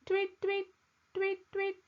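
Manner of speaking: high, bright little tweets; bird tweeting. Acted. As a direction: sing it like a songbird. The grown bird returns singing.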